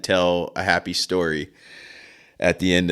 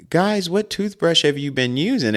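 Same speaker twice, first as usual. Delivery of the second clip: mom asking; 9 semitones higher than usual. Retold or imitated. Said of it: "And my mom goes".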